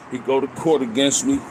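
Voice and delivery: normal talking voice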